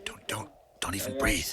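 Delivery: tense unvoiced whisper. Captain to Harrison while hiding, a tense whisper. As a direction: breathe it so no one hears.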